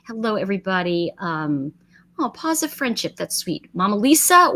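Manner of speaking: ordinary talk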